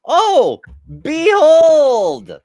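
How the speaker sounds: mock grand proclamation, high and loud; excited exclamation. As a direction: announce it like a herald. Grand exclamation at found link.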